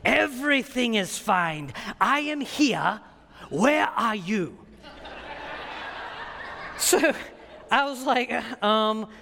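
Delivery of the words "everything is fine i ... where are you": put-on slow, deliberate Kenyan accent. Visiting pastor greets his brother by phone, Kenyan accent. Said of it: "I'll only do his accent once".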